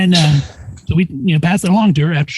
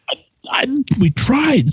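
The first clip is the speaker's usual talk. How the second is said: a mildly meek, higher-pitched reply; sheepish excuse; 8 semitones higher, slower than usual. Retold or imitated. Nurse answering the orthopod's demand, mock sheepish.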